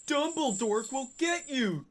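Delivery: high, shrill-pitched mock shout; shouting Draco. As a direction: yell it up high like a bratty teen. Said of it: "Draco shooted".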